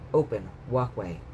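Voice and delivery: plain reading voice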